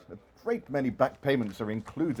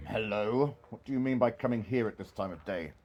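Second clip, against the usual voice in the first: low, gruff feigned reproach; mock stern boss; 5 semitones lower than usual. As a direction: pretend to scold the late clerk.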